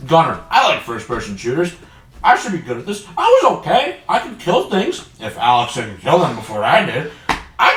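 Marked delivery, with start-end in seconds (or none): none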